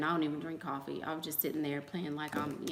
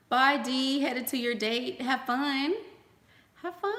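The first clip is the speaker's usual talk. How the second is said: cheery goodbye; 7 semitones higher, 5 dB louder, slower than usual. Real, not acted.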